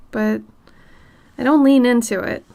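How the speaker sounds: ordinary delivery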